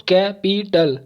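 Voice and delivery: Capital slowly split into three beats; syllable by syllable. Shown as a demonstration. Teacher counting three syllables, capital slow and broken up. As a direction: break capital apart at each syllable. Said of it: "तीन हुआ".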